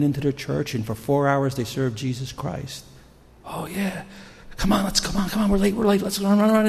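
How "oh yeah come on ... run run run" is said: higher, breathless-paced rush of words; acted-out hurry, pitched up; hurried urging. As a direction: hustle someone along, words tumbling out. Voices couple rushing into church.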